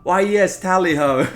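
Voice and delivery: loud hammed-up posh Englishman; tally ho like a stuffy gentleman; reading the character's dialogue in a loud mock-posh voice; posh toff voice. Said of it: "a quest that we're allowed to talk".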